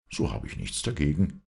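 usual speaking voice

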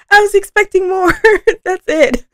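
high, loud, laughing surprise; surprised laughing disbelief. Real, not acted. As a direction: laugh that it's already over. Surprised the song ended.

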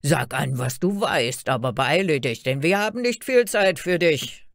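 loud, impatient guard's order; calling out. A narrator's reading. Guards hurry Fairy Tale to perform, impatient call. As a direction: press her loudly, rising at the end. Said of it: "riefen sie".

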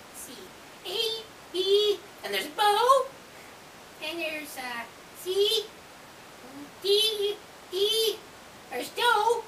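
squeaky raccoon voice. Pretend. Lucky searches rhymes.

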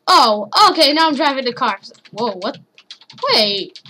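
ordinary delivery

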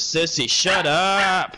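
loud, high, slow angry yell; raging gamer. Real, not acted. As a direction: snap at your sister to shut up. Yells at sibling mid-game.

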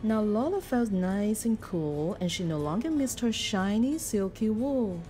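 plain reading voice

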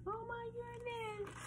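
tiny, high, soft squeal; mock fright. Reader reacts as all the animals run away, mock-frightened squeak.

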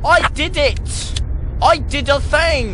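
high, loud victory cry; drawn-out, high-pitched celebration; triumphant. Real, not acted. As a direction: crow with excitement. Celebrating a game success.

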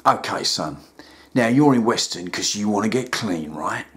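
a deep, calm therapist talking down; deep soothing counsellor; rehab counsellor. Acted.

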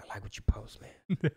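hushed confidential whisper, imitating closeted friends; mock-secret supporter's whisper; secretive whisper. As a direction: whisper it furtively, as if nobody else should hear. Acts out closeted friends secretly agreeing.

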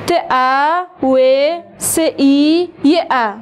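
ت ا و س ای ی ا, each sound slow and separate. Shown as a demonstration. Teacher spelling توصیه out for pupils. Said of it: "هجا کنم برتون".

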